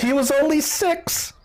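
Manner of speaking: very high, plaintive cry; hammed-up high-pitched grief; mother's wail. Grieving mother answers question about son.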